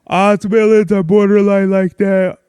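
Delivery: talking through a yawn. Real, not acted. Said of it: "I'm yawning and I don't know why".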